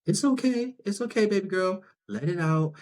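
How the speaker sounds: high, gentle comforting voice; soothing a little girl. Retold or imitated. An adult comforting a crying little girl, high and gentle. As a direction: coo it like calming a crying child.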